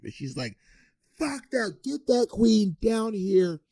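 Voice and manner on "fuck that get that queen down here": high, drawn-out mock yell; hammed-up high screaming; imitated screaming. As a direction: screech it slowly, without full volume. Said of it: "like, screaming".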